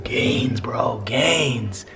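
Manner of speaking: whisper. Real, not acted. Praising the dog eating.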